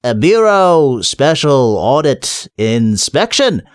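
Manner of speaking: slowing down, unsure how to end the sentence; faltering. Acted. Nobby running out of invented title, faltering and slow. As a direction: lose confidence word by word, slowly. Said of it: "Nobby's voice faltered a bit".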